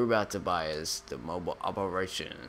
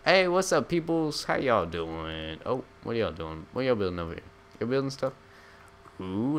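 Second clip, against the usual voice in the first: higher, sing-song jokey greeting; goofy greeter voice; 5 semitones higher than usual. Pretend. Gamer greeting NPC workers in a higher sing-song voice. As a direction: schmooze the workers like a cheesy boss. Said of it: "I'm gonna stop doing that".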